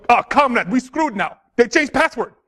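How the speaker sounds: hammed-up Russian accent; terrible Russian accent. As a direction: play a hacker comrade in a bad Russian accent. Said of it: "that's my terrible Russian accent".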